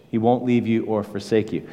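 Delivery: normal talking voice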